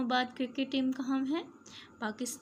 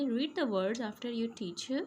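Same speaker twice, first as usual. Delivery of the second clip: usual speaking voice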